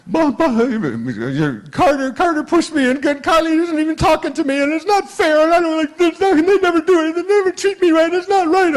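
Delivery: put-on very high, loud wailing child complaint; sobbing little girl. Crying granddaughter complains to grandpa, high and wailing. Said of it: "sobbing, tears running down her face".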